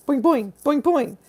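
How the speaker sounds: high springy boings; boing like a bouncing trampoline; bouncy boing noises. Pretend. Streamer playing on a pixie trampoline, high bouncy boings.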